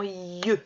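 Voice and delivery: "ieux" with a long i gliding into eu; drawn-out I. Shown as a demonstration. Teacher naming the sound heard in pieux, i drawn out. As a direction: hold the i of "ieux".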